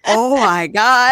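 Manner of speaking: stunned oh my God. Retold or imitated. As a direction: drag it out in wonder. Husband zoomed in on camera.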